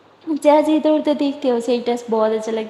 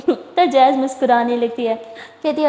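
plain reading voice

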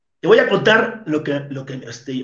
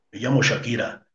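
deep voice mimic; 5 semitones lower, 5 dB quieter than usual. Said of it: "ya hizo la voz muy".

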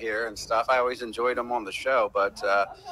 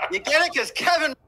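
squeaky punchline. Pretend. Mock punchline, much higher.

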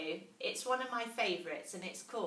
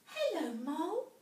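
a tiny mouse gasping in wonder; little mouse voice; 9 semitones higher, 3 dB louder than usual. A narrator's reading.

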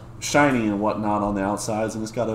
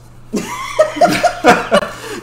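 sudden high squealing laugh; 5 dB louder than usual. Real, not acted. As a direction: burst into a high, delighted laugh.

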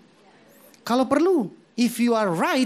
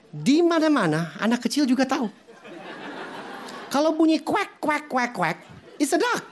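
husband's voice climbing higher with irritation; exasperated husband; 8 semitones higher than usual. Acted. Husband to wife over the duck: higher, exasperated insisting. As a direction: lecture her like it's obvious.

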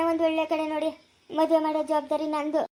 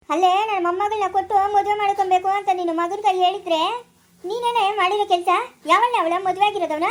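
raised-voice, accusing questions; angry scolding; 4 dB louder than usual. Acted. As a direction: scold her for an outrage. Elder scolds Savitri over son's marriage. (ಕೋಪದಿಂದ ಬೈಯುತ್ತಾ)

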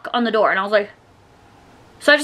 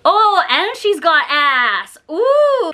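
slow leering drawl ending in a high "Ooh"; mimicking catcaller; 6 semitones higher, much slower than usual. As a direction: play the creep calling after her, slowly. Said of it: "he goes".

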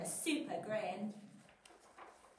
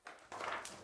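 sudden soft intake of breath; sharp gasp; 10 dB quieter than usual. Acted. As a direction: gasp in suspense. Suspense gasp.

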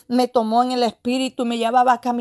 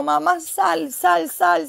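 higher-pitched, repeated urgent plea; imitating cries; 5 semitones higher, slower than usual. People in the vision urging mamá out, imitated high cries. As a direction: re-enact frightened calling at no great volume. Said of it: "Y yo vi a muchos gritos".

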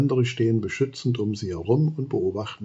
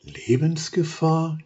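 slow, higher questioning echo of the word; worried question; 6 semitones higher, slower than usual. Acted. Wolf girl echoes the queen, worried. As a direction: Wilma asks back, alarmed. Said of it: "hinterfragt Wilma besorgt".

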